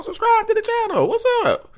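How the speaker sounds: goofy falsetto outro for the channel; silly falsetto. Pretend. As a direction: squeal the sign-off playfully. Silly sign-off voice.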